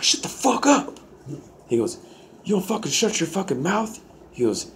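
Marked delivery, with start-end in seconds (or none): 0.0-1.0 very high, strained yell, held back in volume; mock angry yell. Inmate reading his Bible to laughing neighbour, mock angry yell. 2.4-4.0 high, strained mock yell without much volume; imitated angry threat. Bible-reading inmate to the man who laughed, imitated angry threat.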